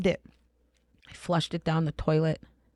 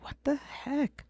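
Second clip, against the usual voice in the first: retold, drawn-out whispered disbelief; high, hushed reenacted bewilderment; bewildered whisper; 10 semitones higher, 6 dB quieter, slower than usual. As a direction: whisper it slowly, as if baffled. Retelling her bewildered reaction.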